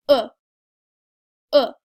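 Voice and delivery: "Uh" short and relaxed, mouth neutral; a quick neutral "uh". Shown as a demonstration. Teacher modelling the French E, short and neutral. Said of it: "make it a little bit less dramatic".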